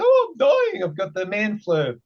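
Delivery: high, whiny sick moan; man flu whine. Retold or imitated. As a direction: whimper like a dying man. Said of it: "And I said".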